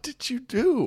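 high, drawn-out mock probing; slow, high-pitched mock worry; high-pitched questioning. Probing the partner in a bit.

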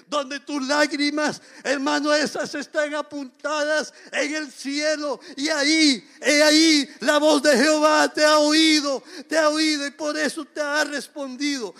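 clamor alto y fuerte con voz quebrada; predicación a todo pulmón. Acted. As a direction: predica con fervor, casi llorando. Preaching climax, crying out. (predicación fervorosa a gritos)

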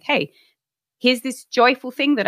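lightly acted, lifted cheery invitation; retold cheerful greeting. Woman sharing joyful news with her partner, lightly cheerful. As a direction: share good news with a slight lift.

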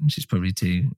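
usual speaking voice

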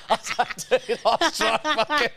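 high, laughing through the words. Real, not acted. Comic retelling his awkward greeting to co-host, laughing. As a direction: barely get it out for laughing. Cracking up at own anecdote.

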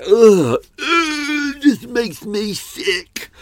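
high, slow hammed-up disgust. Real, not acted. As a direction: groan like it sickens you. Ranting about bad sound effects.

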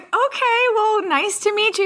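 high-pitched, uneasy mock farewell; awkward politeness. Friends taking leave of the angry customer, retold awkwardly high. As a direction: lift the voice high to escape politely.

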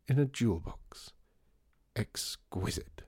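breathy near-whisper, slowing at the end; hushed, drawn-out rapture; hushed relish. A narrator's reading. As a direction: savour it, sinking into a whisper. Savouring his beautiful tea room.

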